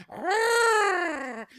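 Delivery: falsetto mock growl; long, high angry rah; high-pitched bear roar. Mama Bear's angry roar.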